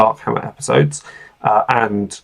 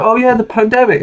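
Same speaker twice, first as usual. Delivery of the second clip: high, breezy brush-off; mock dismissive quote; 11 semitones higher, faster than usual. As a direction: toss it off like it's over. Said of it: "they go".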